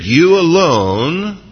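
"You alone" drawn out slowly, YOU leaned on, rising; slow, emphatic "You alone"; emphatic stress. Acted. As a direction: stretch "You alone" with weight on YOU. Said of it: "it's emphatic in the Greek".

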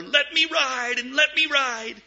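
put-on high, pleading child's cry; a boy crying out to the riverboat. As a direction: beg the boat to stop. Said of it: "he cried out all the more".

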